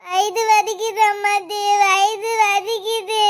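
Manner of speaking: slow, whining wail; crying in pain. Acted. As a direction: wail pitifully to grandmother, dragging the words. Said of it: "வந்ததுல இருந்து அழுதுகிட்டே இருக்கியாம்ப்பா". (பாட்டியிடம் வலியில் அழுதல்)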